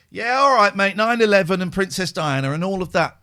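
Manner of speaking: loud, high sarcastic scoff at conspiracy talk; mocking scoff. Sceptical people mocking the cover-up claim, loud sarcastic scoff. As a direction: sneer it like a sceptical mate. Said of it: "people go".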